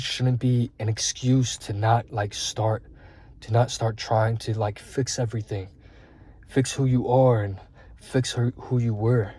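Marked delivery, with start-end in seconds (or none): none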